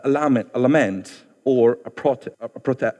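normal talking voice

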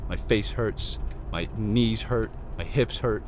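ordinary talk, nothing marked

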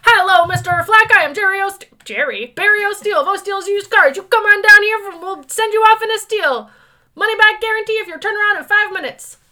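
high, loud, slick car salesman; used car salesman voice. Retold or imitated. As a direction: pitch it like a sleazy dealer. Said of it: "spoke like that for, like, the week".

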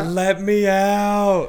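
slow, high, loud wailing plea; hammed-up sobbing yell for help; mock crying plea. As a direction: wail it like a kid locked in a cell. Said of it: "screaming and crying".

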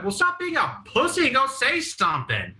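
high, exasperated retold yell; mock screaming. Teen screaming at his crying friend, mock exasperated. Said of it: "I started screaming at him".

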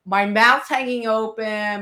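normal talking voice

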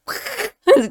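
creepy ghost cackle. Pretend. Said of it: "put hair in front of my face and be like".

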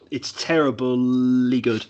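slow, dry "terribly good" with a pause before it; deadpan terribly good. Shown as a demonstration. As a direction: draw out "terribly good" like a backhanded compliment. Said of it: "I always say that".

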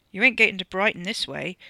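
ordinary delivery, nothing marked

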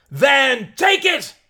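high, loud, slow command; scream. Acted. Mary flinging money to the crowd, slow high scream. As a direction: scream it out with full release. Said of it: "she screamed".

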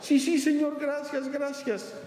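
high, breathless eager answer; eager desperate man. Acted. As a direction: grab at the voice with hope. Man thanks God.

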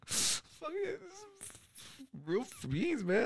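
tiny, high, soft tearful squeak; mock crying. Tour mate tasting a burrito at last, mock crying. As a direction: squeeze it out high and quiet as if weeping. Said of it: "I start crying".

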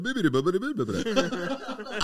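high-pitched babbling game character; Sims gibberish. Retold or imitated. Imitating trapped Sims wandering in circles, high nonsense babble. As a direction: babble aimlessly in a high cartoon voice. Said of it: "when you play The Sims".

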